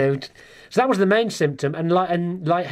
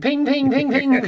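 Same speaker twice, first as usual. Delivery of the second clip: high, rapid-fire ping noises; imitated phone pings; 10 semitones higher, 4 dB louder, slower than usual. Comic telling friends of test result alert, imitated pings.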